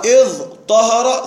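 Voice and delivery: اظ طهر slow, broken before a heavy ṭ; substituted emphatic ta. Shown as a demonstration. Teacher showing the form after ta becomes ta', slow emphatic pronunciation. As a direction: sound out اظ طهر as iẓ... ṭahara. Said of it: "ثم أبدلت التاء طاء".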